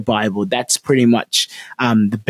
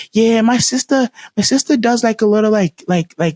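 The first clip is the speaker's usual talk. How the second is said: put-on higher, hesitant child's voice; imitated little girl; 9 semitones higher than usual. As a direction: play a little girl confiding, pitched up. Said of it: "the little girl begins to go like".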